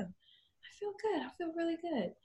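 high, hushed imitation; soft happy client. Client answering "how do you feel", soft high contented voice. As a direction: answer softly, up high, near a whisper. Client reassures photographer when asked.

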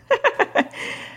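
quick amused laugh; a brief laugh; short laugh. Real, not acted. Laughs at own joke.

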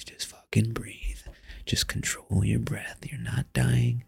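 slow whispered self-coaching; slow whispered breathing mantra; whispering to himself. Shown as a demonstration. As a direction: whisper it to calm yourself, slowly. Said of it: "talking himself down".

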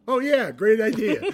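high, loud, laughing through the words; quoting the director while cracking up. Retold or imitated. Retelling the director's reaction, laughing loudly. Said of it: "he went".

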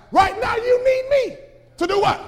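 loud, high preaching shout; preaching at full force. Acted. Preacher to church, loud high preaching shout. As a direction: drive it home to the congregation.

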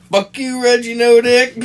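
high, loud furious yell at the killer; angry yell. Retold or imitated. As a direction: scream it right in his face. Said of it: "They were both like".

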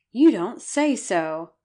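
slow, loud astonished exclamation; surprised. Acted. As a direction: gasp it out in disbelief. Astonished reply to the news.

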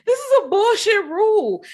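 amused disbelief at the rule; laughing outrage. Real, not acted. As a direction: cackle out the complaint.

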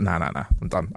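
low, quiet brush-off; retold dismissal. Refusing the passenger beside him, low dismissal. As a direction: wave him away softly, voice dropped low. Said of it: "I was like".

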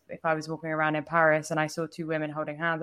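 usual speaking voice

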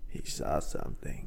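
low, slow eerie whisper; spooky whisper. Pretend. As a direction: breathe it out like a horror trailer. Spooky echo of the line.

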